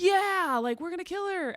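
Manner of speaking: high-pitched rallying cry, hammed up; mock mob cheer. Voicing the internet piling on, mock excited cheer.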